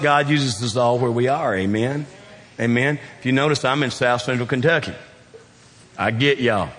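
ordinary talk

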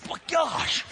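high, startled mock yell; high-pitched retold shout; exasperated outburst. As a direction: blurt it out high, reenacting your own fluster. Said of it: "I'm, like, going".

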